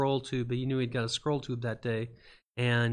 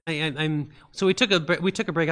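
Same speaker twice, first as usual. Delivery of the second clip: ordinary talk, nothing marked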